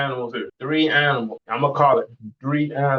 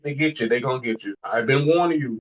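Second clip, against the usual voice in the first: normal talking voice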